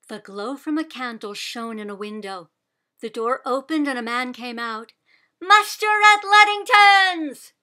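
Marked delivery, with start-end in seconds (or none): 5.4-7.5 slow, much louder and higher call; crying out. A narrator's reading. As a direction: call out to wake the farm. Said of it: "Sybil cried".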